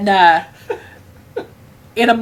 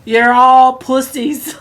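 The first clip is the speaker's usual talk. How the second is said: louder, slow mocking jeer; gruff taunting grandpa; 4 semitones higher, 6 dB louder, much slower than usual. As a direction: sneer at them like a tough old hunter. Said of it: "my grandpa says".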